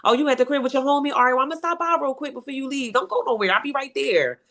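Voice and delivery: playing it sweet on the phone to the boyfriend. Girlfriend sweetly phoning the man.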